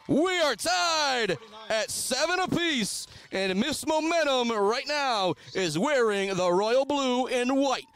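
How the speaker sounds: high, slow, stretched-out announcer cry; excited sportscaster milking the moment; triumphant score call. Real, not acted. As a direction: proclaim the tie, drawing out each phrase. Announcing the tying extra point.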